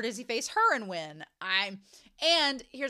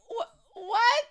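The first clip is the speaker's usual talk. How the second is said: high, rising loud what; shocked what; 12 semitones higher, 7 dB louder than usual. Retold or imitated. Shin hearing he must let Lo fight, shocked blurt. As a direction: caught off guard, blurt it.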